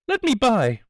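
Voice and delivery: high, loud, panicked shriek; terrified shriek. A narrator's reading. Trapped woman shrieking to escape the gunman, high and loud. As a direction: scream to get past the man at the door. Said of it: "she shrieked".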